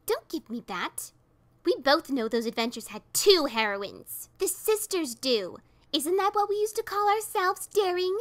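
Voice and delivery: loud, rising toward high; loud bitter reproach; angry accusation. A narrator's reading. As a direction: confront her sister. Sister confronts old partner.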